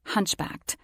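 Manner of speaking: plain reading voice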